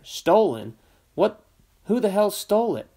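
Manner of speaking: a father's voice jumping high and loud in anger; furious outburst. A narrator's reading. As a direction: demand it like he can't believe it. Father furious about stolen rifle.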